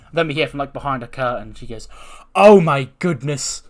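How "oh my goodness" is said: loud, high, drawn-out exclamation; hammed-up awe, raised and slow; theatrical gushing. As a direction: gush grandly, stretching it out. Becky's theatrical entrance, acted.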